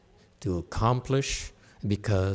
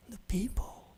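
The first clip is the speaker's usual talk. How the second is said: whispered; 8 semitones higher, 12 dB quieter than usual. Acted. Teacher revealing the real key to investing, whispered.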